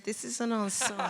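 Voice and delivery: repeating it through laughter; laughing quote. Retold or imitated. Actress repeats Harry's line while laughing. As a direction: chuckle the words out.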